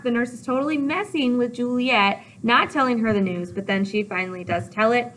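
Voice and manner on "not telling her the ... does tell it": ordinary delivery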